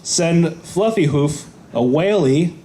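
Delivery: normal talking voice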